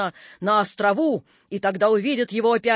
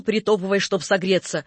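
plain reading voice

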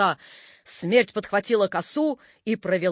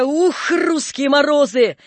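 loud, slow, higher exclamation; hearty grunt; 6 semitones higher, 5 dB louder, slower than usual. A narrator's reading. Napoleon warmed by rum, hearty exclamation. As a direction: relish it after a warming drink. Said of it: "крякнул Наполеон".

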